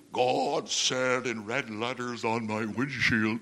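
low, slow mock voice of God; lightly hammed divine voice; mock booming God voice. Shown as a demonstration. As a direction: deepen it like a spooky heavenly voice. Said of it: "notice how I'm saying this".

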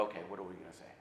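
hushed, low, secretive disciples; huddled whisper. Retold or imitated.

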